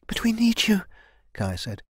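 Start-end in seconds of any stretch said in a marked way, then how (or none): 0.1-0.9 high, half-whispered plea; pleading. Acted. Kai pleads with the dying elder, pleading. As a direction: plead in a thin, lifted voice.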